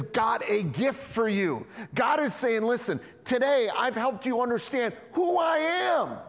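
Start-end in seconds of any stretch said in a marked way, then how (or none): 2.6-6.2 higher, louder proclaiming; preached shout as the voice of God; God calling out. Acted. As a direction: call out like God urging his people. God speaking, preacher voicing.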